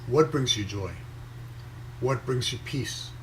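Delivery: usual speaking voice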